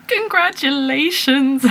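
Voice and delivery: high, slow and tender; a drawn-out delighted congratulations; sweet congratulations. Retold or imitated. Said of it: "she just went".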